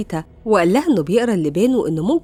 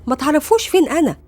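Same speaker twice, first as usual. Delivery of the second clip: sharp retort, pitched up; 7 semitones higher than usual. A narrator's reading. Greta answering her sister about the ex, sharply. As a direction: narrator lightly acting an edgy, higher-voiced warning. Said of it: "قالت بحدة".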